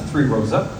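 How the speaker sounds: ordinary delivery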